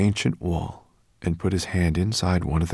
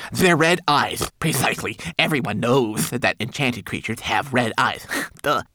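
high, louder little know-it-all; insistent homunculus; 6 semitones higher, 3 dB louder than usual. A narrator's reading. Homunculus explains enchanted creatures' red eyes, insistent.

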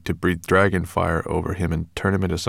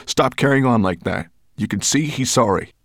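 firm scolding; 4 semitones higher, 4 dB louder than usual. Acted. Ben scolding Sorrel.

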